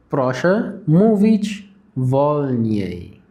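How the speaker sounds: very slow, each syllable drawn out; slow demo. Shown as a demonstration. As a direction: say it slowly like a teacher modelling. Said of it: "أستأذنك تتكلم بالراحة".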